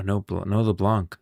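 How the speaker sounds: ordinary talk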